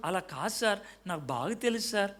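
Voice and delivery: a junior softly disagreeing with his sir; junior's soft reply. Retold or imitated. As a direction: say it gently but firmly, as a junior. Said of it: "చిన్నోడు కాబట్టి నెమ్మది చెప్పావు".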